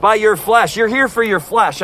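normal talking voice

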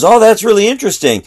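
high, intrigued retold reaction; keen interest. Retold reply of an interested young man.

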